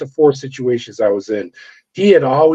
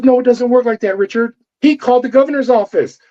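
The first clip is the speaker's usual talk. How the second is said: much higher mimic of the woman agent, accusing; accusing female agent; 8 semitones higher than usual. Female FBI agent confronting the officer, much higher mocking voice. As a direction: pitch up to play her as she gets in his face.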